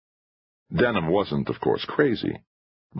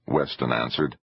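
plain reading voice

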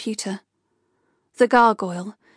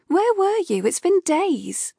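exasperated demand; 9 semitones higher than usual. Acted. Kay demanding Rupert's whereabouts.

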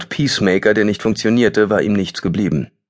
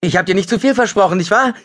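much higher, fast and giddy; giddy triumph; 14 semitones higher, faster than usual. Acted. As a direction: crow it out, half-mad with relief. Giddy triumph after finding hut.

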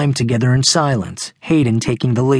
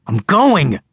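sharp, higher-pitched snap; snapped; 5 semitones higher than usual. A narrator's reading. Nervous boy snapping back at his impatient friend. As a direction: briefly lose your composure. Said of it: "Russell snapped with a momentary loss of composure".

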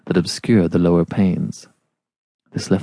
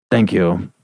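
dry; 4 semitones lower than usual. Acted. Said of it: "he said dryly".

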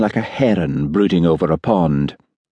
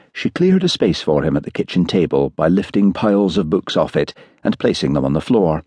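usual speaking voice

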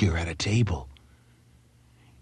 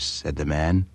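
usual speaking voice

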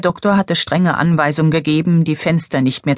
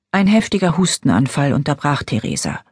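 ordinary delivery

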